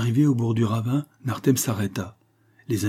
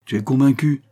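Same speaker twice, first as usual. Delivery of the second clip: higher, louder triumphant exclamation; 5 semitones higher, 6 dB louder than usual. A narrator's reading. Husband to his doubting wife, triumphant. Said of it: "exclama son mari, triomphant".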